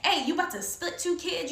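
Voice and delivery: urgent, high-pitched interruption; super upset. Retold or imitated. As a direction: cut in, alarmed and indignant. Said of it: "I'm super upset".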